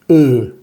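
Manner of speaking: E as rounded "eu"; drawn-out, falling rounded E; unaccented French E. Shown as a demonstration. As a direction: round the lips for E, French schwa. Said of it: "como vimos anteriormente".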